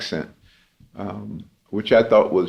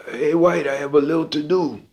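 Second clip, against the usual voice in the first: hint of an old French-accented bandleader, higher pitched; light French accent; 6 semitones higher than usual. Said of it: "He had a thick French accent".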